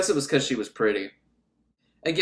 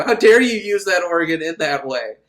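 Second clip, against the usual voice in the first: louder, only slightly raised reprimand; mock church scolding; 7 dB louder than usual. Repeated as church saying, second take.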